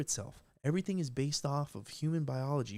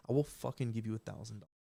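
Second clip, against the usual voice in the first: usual speaking voice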